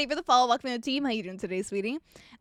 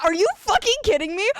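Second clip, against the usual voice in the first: higher, louder, incredulous snap; exasperated retort; 7 semitones higher, 5 dB louder than usual. Retold or imitated. Barista retelling her comeback to a rude customer, incredulous snap. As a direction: fire back in disbelief. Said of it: "And I was like".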